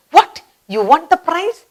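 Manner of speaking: high, amazed question; surprised. Shown as a demonstration. Teacher acting out winning a lottery prize. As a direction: gasp at a lottery win. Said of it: "It's a surprise".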